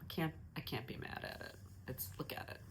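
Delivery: soft unvoiced whisper to herself. Real, not acted. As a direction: breathe it out as a private confession.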